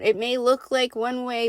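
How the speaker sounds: ordinary talk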